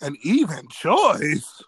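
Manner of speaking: slow disbelieving repeat; incredulous echo. Real, not acted. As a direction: say it back like you can't believe it. Reacting to a quoted claim.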